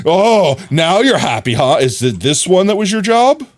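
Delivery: sarcastic. Real, not acted. Sarcastic at satisfied villager.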